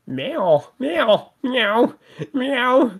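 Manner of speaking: high, meowing kitten voice. Acted. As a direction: beg like a little kitten. Cat begging and meowing.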